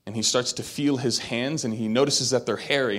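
usual speaking voice

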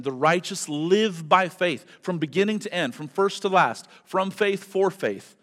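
fervent, loud, high preaching. Acted. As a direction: preach it out to the congregation. Preaching at full force.

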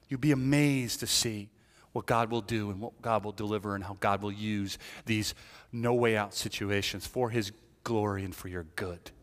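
ordinary talk, nothing marked

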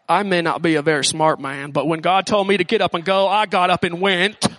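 a light Southern Forrest Gump impression. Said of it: "That's my best Forrest Gump I got right there".

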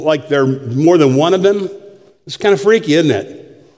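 usual speaking voice

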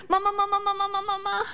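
put-on high eager kid calling Mama; child tattling. Son racing to mom to tattle, high calling. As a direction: call out like an excited toddler. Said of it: "run, run, run".

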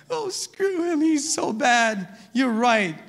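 high-pitched start, then slowly drawn-out griping; mock griping women. Preacher imitating movie wives trashing a husband, mock griping. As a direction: imitate women commiserating over wine.

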